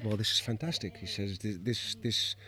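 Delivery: put-on mentor's soft French accent; quiet French-accented mentor.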